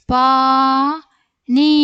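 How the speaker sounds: very slow, loud, stretched vowels; syllable by syllable. Shown as a demonstration. Teacher sounding out a word for young readers, syllable by syllable. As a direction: hold each syllable long and firm.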